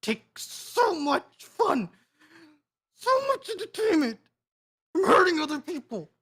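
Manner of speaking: high, strained disbelief; appalled accusation. Acted. Player voices outrage at villain.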